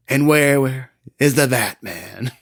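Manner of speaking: slow mocking villain question; Joker impression. Joker taunts the crowd, a slow mocking call.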